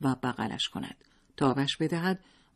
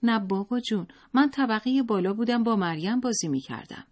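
put-on high, innocent little boy; high boy's voice, eager to explain; child's voice; 8 semitones higher than usual. A narrator's reading. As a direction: answer like a small boy. Child answering father.

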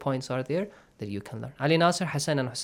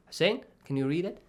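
ordinary talk

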